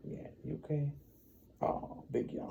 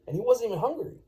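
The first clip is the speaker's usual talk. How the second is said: ordinary delivery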